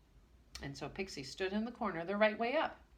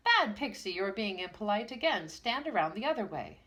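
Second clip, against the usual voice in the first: raised, scolding teacher voice; stern schoolmaster; 4 semitones higher, 6 dB louder than usual. Acted. Schoolmaster Grimm scolds Pixie, loud and stern. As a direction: scold the naughty pupil sharply.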